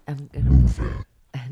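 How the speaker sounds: deep slow mock voice; lightly mocking partner's voice; squeaky falsetto mockery. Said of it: "that's not what I sound like".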